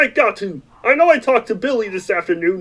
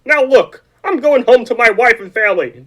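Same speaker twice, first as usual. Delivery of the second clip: ordinary delivery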